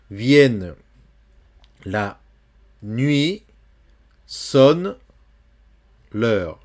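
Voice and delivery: vers lu lentement, détaché; poem reading. Shown as a demonstration. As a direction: lire le vers avec soin. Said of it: "lecture du poème". (lecture de poème appuyée)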